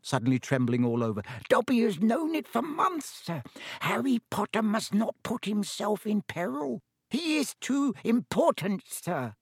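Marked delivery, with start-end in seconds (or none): 1.5-9.4 high, squeaky elf voice; anxious house-elf Dobby. Acted. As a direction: plead earnestly as a high-voiced little elf.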